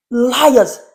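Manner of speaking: ordinary delivery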